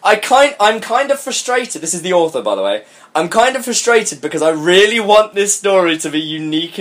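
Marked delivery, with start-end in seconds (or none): none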